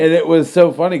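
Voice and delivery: loud, high and fast; quick amused burst; amused excitement. Real, not acted. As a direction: rush it out, tickled. Laughing retelling.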